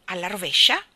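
grumbled, offended question; grumbling. A narrator's reading. Old elephant grumbles at Jane's remark, offended. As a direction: mutter it back, put out. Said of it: "brontolò".

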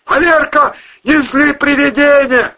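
high, loud warning; shout. A narrator's reading. Man warning his friend off angering ghosts, shouting. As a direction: shout to make him stop. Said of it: "крикнул Волына".

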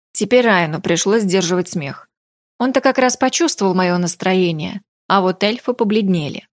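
ordinary delivery

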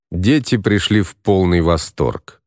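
plain reading voice

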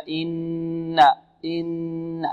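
إن with a long, fully held nn; full ghunnah on the noon. Shown as a demonstration. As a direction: draw out the doubled ن of إن as a long nasal hum. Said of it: "غنة أكمل هكذا". (إن بغنة كاملة على النون المشددة)

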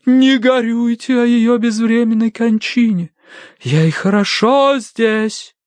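slow, high otherworldly wail; spirit-channelling wail. A narrator's reading. Madame consoles from the beyond, slow high wail. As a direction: draw it out like a spirit.

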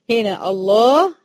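الله with a thick, heavy lam. Shown as a demonstration. Teacher pointing out the heavy lam to the girls. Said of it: "اللام بتاعت الله".

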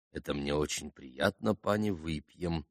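soft, self-important acceptance; pompous, gracious. A narrator's reading. Polish gentleman accepting a toast, pompous and benevolent. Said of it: "важно и благосклонно проговорил пан".

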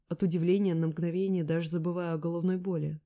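plain reading voice

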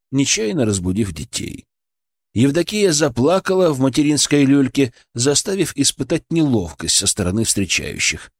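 ordinary delivery

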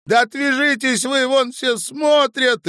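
very high, loud, stretched protest; exasperated. A narrator's reading. Woman to clinging man as passers-by stare, exasperated. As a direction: shout it shrilly to get rid of him. Acted quarrel in the street.

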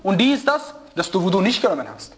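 ordinary delivery

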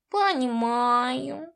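slow, odd cat voice; a sly talking cat, drawn out; cat spirit. A narrator's reading.

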